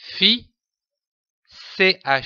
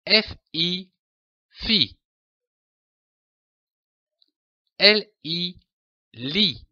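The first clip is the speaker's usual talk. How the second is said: name each letter, then say the syllable; careful classroom letter-by-letter drill; spelling drill; 4 semitones higher than usual. Shown as a demonstration. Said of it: "constructions de syllabes à connaître".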